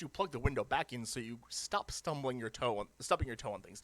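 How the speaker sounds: usual speaking voice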